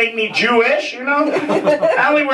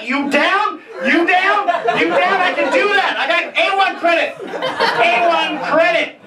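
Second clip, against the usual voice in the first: hyped-up offer; 7 semitones higher than usual. Real, not acted.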